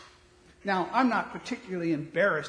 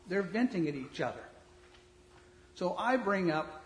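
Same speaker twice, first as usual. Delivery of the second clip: usual speaking voice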